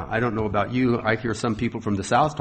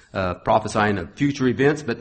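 ordinary talk, nothing marked

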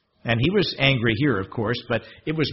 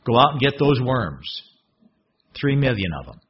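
usual speaking voice

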